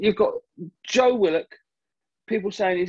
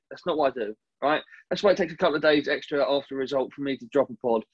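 usual speaking voice

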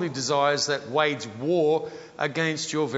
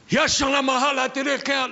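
speaking in tongues; 8 semitones higher, 5 dB louder than usual. Shown as a demonstration. Praying in tongues.

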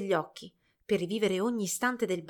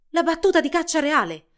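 high, loud excited cry; excited shriek; 7 semitones higher, 8 dB louder than usual. A narrator's reading. Village girl announcing the royal hunt, excited shriek. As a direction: shout the news with a thrill. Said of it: "strillò una ragazza del villaggio".

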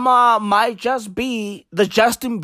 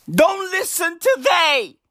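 higher mock orator voice; mock Obama line; 7 semitones higher than usual. Shown as a demonstration. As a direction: repeat the slogan like a preacher. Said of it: "Bruv, don't listen to they".

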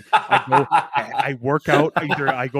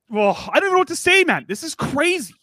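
excited; 13 semitones higher, 3 dB louder than usual. Real, not acted. Excited after Cup Final win.

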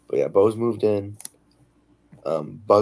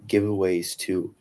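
usual speaking voice

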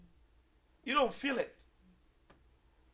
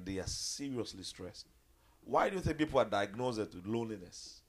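ordinary talk, nothing marked

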